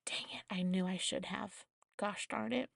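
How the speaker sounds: breathy hushed dang it, no voice; whispered regret. Retold or imitated. Patient regretting a missed prank to the surgeon, whispered.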